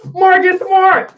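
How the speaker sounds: shouts the name high and loud, slowly, in disbelief; fed-up yell at the screen; exasperated shout. Real, not acted. Exasperated rant about last shot.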